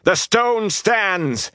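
high, drawn-out rallying cry; shouted battle cry. Acted. Rand leaps at the Myrddraal with a battle cry, shouting. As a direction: shout each word long and high. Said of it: "he shouted as he leaped".

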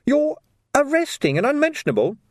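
very high-pitched disbelief; incredulous young constable. A narrator's reading. Young constable questioning the sergeant's arrest, high and incredulous. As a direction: ask it high, in astonishment.

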